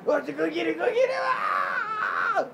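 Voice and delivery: very high squealing encouragement; excited cheering. Real, not acted.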